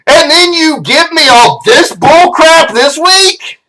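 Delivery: high-pitched, fed-up rant; outraged complaint that sags at the end; exasperated scolding. Acted. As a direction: tear into them, worked up and indignant. Scolds hosts, heart attack coming.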